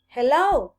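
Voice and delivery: loud call into the clearing. A narrator's reading. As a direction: call out to anyone nearby. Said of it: "he called".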